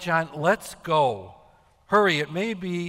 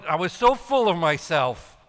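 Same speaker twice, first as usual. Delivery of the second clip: normal talking voice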